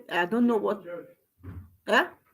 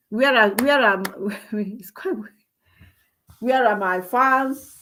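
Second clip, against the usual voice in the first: ordinary talk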